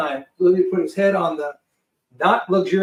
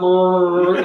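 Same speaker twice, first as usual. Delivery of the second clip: imitated engine roar "brrrr"; loud rolled plane drone; loud engine noise; 7 dB louder than usual. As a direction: mimic a roaring plane motor.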